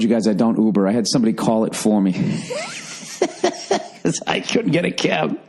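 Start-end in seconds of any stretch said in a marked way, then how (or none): 2.2-4.0 short high burst of real laughter. Real, not acted. Comic admitting he used Uber laughs at himself. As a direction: laugh at yourself mid-story.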